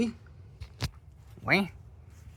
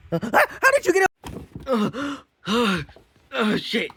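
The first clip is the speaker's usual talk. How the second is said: much higher, startled and frightened; panicked shock; 8 semitones higher than usual. Acted. Host startled by a guest getting up, high and frightened. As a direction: recoil in alarm.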